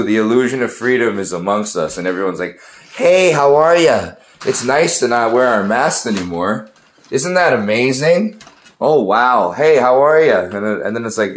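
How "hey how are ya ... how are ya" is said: high, loud, sing-song fake friendliness; mock cheery greeter. People greeting after mask rules lift, mock cheerful. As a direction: parody everyone gushing when masks come off. Mocking people freed from masks.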